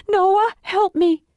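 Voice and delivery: high, drawn-out plea; desperate call for help. Acted. Daughter calls for help.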